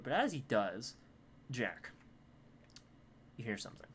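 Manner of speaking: ordinary talk, nothing marked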